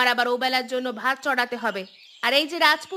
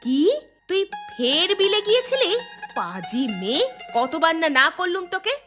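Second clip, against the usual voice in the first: outraged scolding; 3 semitones higher than usual. Acted. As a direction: catch the child out, appalled. Mother learns of forbidden trip. (রাগী অবাক ধমক)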